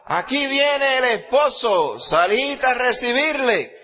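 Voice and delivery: high, loud, slow herald's call; midnight cry. A narrator's reading. The midnight cry announcing the bridegroom, high and loud. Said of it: "se oyó un clamor".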